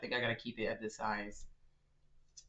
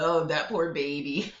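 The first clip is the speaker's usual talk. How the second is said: mock pity; 4 semitones higher, 11 dB louder than usual. Real, not acted. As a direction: cackle out fake sympathy. Pities a baby in letter.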